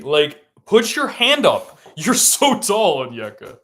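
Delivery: high, loud, stretched-out shouting; exasperated yell. Real, not acted. Fan to Onyeka over an undefended three, exasperated yell. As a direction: holler each word slowly in real frustration.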